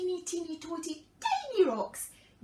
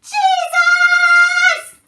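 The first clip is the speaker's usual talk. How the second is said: long sung-out shout, much higher and louder; 20 dB louder than usual. Acted. Blind man shouting loudly to Jesus from the roadside. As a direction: call out his name as loud as you can. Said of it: "started to shout as loud as he could".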